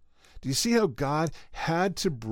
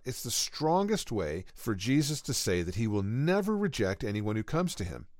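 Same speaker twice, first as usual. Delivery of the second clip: ordinary talk, nothing marked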